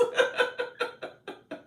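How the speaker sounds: loud high breathy laughter. Real, not acted. Teased over names.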